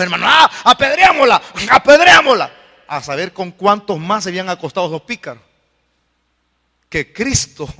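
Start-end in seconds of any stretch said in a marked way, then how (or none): 0.3-2.5 high, loud cry to stone her; angry mob shout. Acted. Preacher acts out the mob accusing the woman, shouting. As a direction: whip up the crowd with a hammed-up shout.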